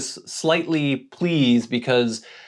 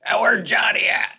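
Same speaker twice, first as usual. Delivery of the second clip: imitating homeless man; 11 semitones higher than usual. Homeless man asks about Johnny.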